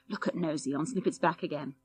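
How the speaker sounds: ordinary delivery, nothing marked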